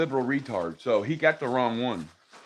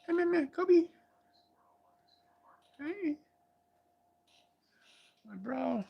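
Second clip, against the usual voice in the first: high pet voice; 13 semitones higher, 6 dB quieter, slower than usual. Real, not acted. Calling his dog sweetly.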